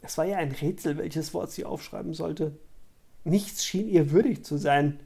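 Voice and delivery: ordinary delivery, nothing marked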